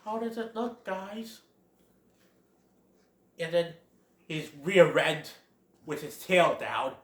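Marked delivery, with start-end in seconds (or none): 0.0-1.4 small, soft, raised voice; Bambi. Acted. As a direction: ask the friends timidly, high and quiet. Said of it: "he's all like".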